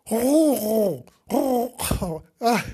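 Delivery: high fluttering coo; dove cooing. Retold or imitated. Storyteller attempts the grey doves' call, cooing. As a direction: imitate a dove's throaty call. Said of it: "there were the grey doves who said".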